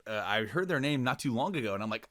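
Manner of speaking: usual speaking voice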